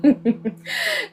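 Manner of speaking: short high burst of laughter; a real laugh, high and quick. Real, not acted. Laughing at puzzle pressure joke.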